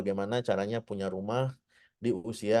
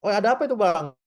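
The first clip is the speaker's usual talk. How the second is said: pitched up high to mirror a loud Sumatran talker; matching a high voice; 8 semitones higher, 4 dB louder, faster than usual. Retold or imitated. Teacher mirrors a Sumatran speaker's high voice, pitched up high. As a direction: lift your voice up high to match his. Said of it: "dia ngomongnya tinggi karena orang Sumatera".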